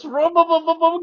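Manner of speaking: high revving vroom and boom noises; engine noises. Retold or imitated. Mimicking the car during burnouts with engine noises.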